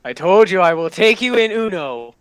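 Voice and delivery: usual speaking voice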